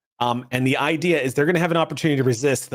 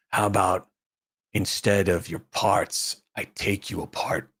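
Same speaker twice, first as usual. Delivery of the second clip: loom over them and promise violence quietly; menacing alien thug; 6 semitones lower, 3 dB quieter than usual. Acted. Alien thug threatens the visitor.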